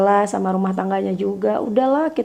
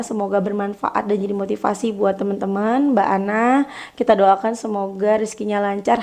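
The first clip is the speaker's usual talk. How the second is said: normal talking voice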